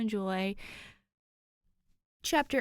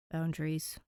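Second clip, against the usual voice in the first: low, soft, sulky mutter; lightly acted dejection; dejected mutter; 7 semitones lower, 5 dB quieter than usual. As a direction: give in grudgingly, under your breath. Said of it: "Danny mutters dejectedly".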